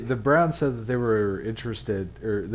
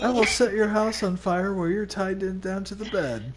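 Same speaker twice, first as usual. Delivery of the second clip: clickbait video narrator; 9 semitones higher than usual. Pretend. YouTuber threat parody.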